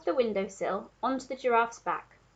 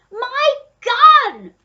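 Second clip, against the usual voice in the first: high, very loud furious yell; duke shouting; 16 dB louder than usual. A narrator's reading. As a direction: yell the demand with all your force. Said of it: "the Duke was shouting".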